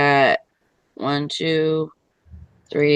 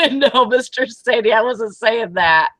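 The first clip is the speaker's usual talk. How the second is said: usual speaking voice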